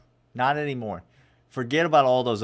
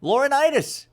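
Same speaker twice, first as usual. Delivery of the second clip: much higher, louder hype call to the fans; hyped callout; 12 semitones higher, 4 dB louder than usual. Acted. Podcast host calling out to Ohio State fans, hyped. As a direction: shout it out like a ring announcer.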